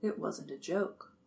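hushed, stricken murmur; quiet and stricken. A narrator's reading. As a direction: say it softly, as if stricken.

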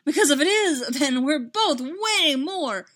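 exasperated, emphatic character line; heated outburst. A narrator's reading. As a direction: push it out loud and drawn out. Acted heated exchange in story.

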